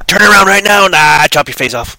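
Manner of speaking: playful zombie threat; mock warning shout. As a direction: bark it out as a sudden warning.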